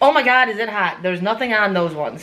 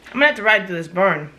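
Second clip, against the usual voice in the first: ordinary delivery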